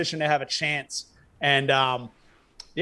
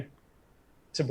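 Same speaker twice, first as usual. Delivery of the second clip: normal talking voice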